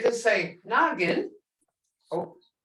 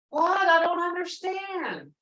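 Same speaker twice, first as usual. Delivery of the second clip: mock confused teacher; 7 semitones higher than usual. Shown as a demonstration. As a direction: pretend not to understand the bad version. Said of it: "teacher teasing mispronunciation".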